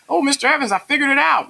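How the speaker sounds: very high, loud pupil imitation; high-pitched mock eagerness; excited student voice. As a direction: play a kid bursting with the answer. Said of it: "your kids are going".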